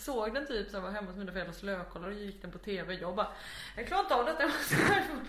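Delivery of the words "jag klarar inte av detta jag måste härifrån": high, loud, laughing distress; mock panic. Viewer can't take The Nutcracker on TV, mock panicked cry. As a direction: re-enact fleeing in hammed-up dread. Retold reaction to scary film.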